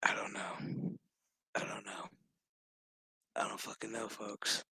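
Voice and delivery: very soft, near-whispered aside; hushed bewilderment. Real, not acted. Host giving up to the folks, hushed bewilderment. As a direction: mutter it low under your breath.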